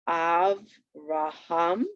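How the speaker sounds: AVRAHAM very slow, split av-ra-ham, rising last; syllable by syllable. Shown as a demonstration. Teacher showing where the accent falls, "Avraham" slowly broken up. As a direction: stretch "Avraham" into three separate syllables. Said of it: "You could hear it naturally there".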